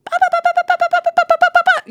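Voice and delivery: high mocking babble, ignoring him; mock babbling. Preacher mocking her own ranting, high babble. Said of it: "I kept being like".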